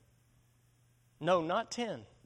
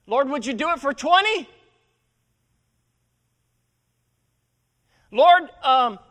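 louder desperate plea; 6 semitones higher, 8 dB louder than usual. Retold or imitated. Abraham pushing God down to twenty.

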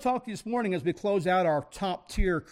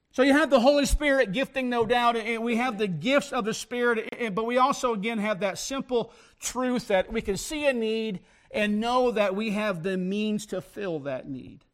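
preaching; 4 semitones higher than usual. Real, not acted. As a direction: preach it with rising fire. Preacher builds to full force.

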